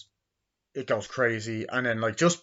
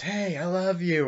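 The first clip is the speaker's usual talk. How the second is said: high, slow imitated call; put-on lovestruck call, stretched; retold love declaration; 8 semitones higher than usual. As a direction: call it out high and drawn out, as the character.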